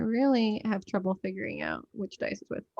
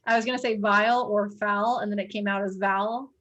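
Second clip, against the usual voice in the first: ordinary delivery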